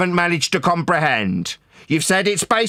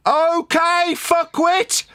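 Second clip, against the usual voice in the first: high-pitched, drawn-out exasperated demand; angry; 9 semitones higher, slower than usual. Real, not acted. Fed-up debater challenging opponent, angry. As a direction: demand attention with real anger. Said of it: "You seem a little angry".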